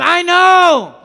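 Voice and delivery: high shouted I know, full force; shout again. Real, not acted. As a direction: shout it even bigger. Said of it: "One more time".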